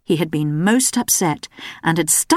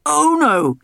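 protesting king; 10 semitones higher than usual. A narrator's reading. King protesting as his daughter advances. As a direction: back away protesting like a king. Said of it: "he protested".

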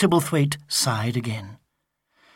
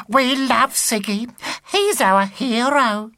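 higher, slow gushing grannies; adoring old ladies; 8 semitones higher, slower than usual. Acted. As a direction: swoon over your hero.

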